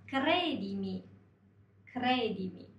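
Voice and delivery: クレディミ with trilled R after the K; firmly rolled R. Shown as a demonstration. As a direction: roll the R hard in クレディミ. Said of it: "しっかり巻くということですね". (「クレディミ」のRをしっかり巻く)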